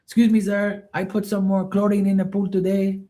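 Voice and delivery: put-on high, polite Filipino-accented "sir" voice; imitating Filipino pool guy. Pool cleaner greeting Mark politely, in a high Filipino-accented voice. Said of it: "he'll be like".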